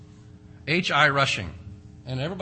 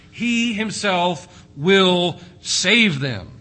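slow, loud stress on "He himself will save"; emphatic, slow; 4 semitones higher, 7 dB louder, much slower than usual. Acted. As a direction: drive home "He himself" slowly. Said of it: "very emphatic".

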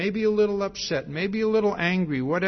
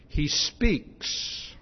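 hushed reading; 2 semitones lower than usual. Shown as a demonstration. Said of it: "And that phrase".

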